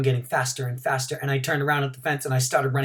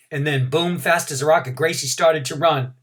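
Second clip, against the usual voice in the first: plain reading voice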